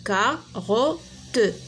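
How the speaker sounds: كاروت ممدودة ببطء شديد مع راء فرنسية; مد كاروت مقطعاً مقطعاً; نطق بطيء مقطع بمقطع. Shown as a demonstration. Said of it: "اون كاروت". (كاروت بنطق بطيء جداً)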